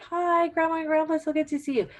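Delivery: high, bright sing-song hello; cheery family greeting. Retold or imitated. Relatives rushing in to greet grandparents, high cheery greeting. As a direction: gush like relatives bursting into the room. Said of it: "they're like".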